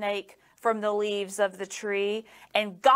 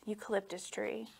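ordinary delivery

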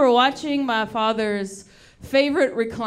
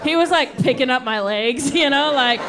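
normal talking voice

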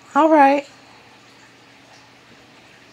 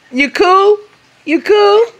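put-on baby talk; 11 semitones higher than usual. Mother checking on the baby playfully.

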